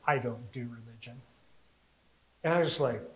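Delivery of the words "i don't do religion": low, quiet shutdown; softly dismissive, pitched well down; dismissive lady. Retold or imitated. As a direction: imitate a curt brush-off, voice dropped. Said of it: "this lady goes".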